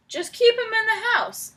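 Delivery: high, loud firm order. Acted. Farmer Brown ordering Bob to keep Duck inside. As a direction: give a firm command. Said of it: "ordered Farmer Brown".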